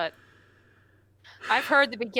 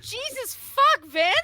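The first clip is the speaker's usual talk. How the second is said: high, slow exclamation of shock; high drawn-out shocked cry; shocked outburst; 11 semitones higher, 4 dB louder, slower than usual. Real, not acted. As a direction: blurt it out, startled. Shocked outburst at bikini remark.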